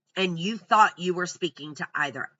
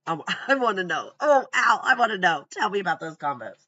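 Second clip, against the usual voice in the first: eager plea; 5 dB louder than usual. Real, not acted. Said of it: "eager plea to Al".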